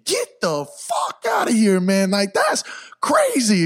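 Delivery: slow, high, disbelieving shout; real astonished exclamation; amazed shout. Real, not acted. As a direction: let the amazement burst out slowly. Amazed by the dog coincidence.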